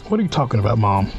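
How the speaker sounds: ordinary talk, nothing marked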